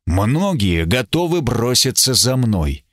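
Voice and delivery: usual speaking voice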